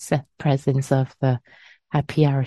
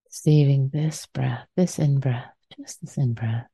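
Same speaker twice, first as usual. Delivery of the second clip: guided meditation. Acted. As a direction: ease them gently into stillness.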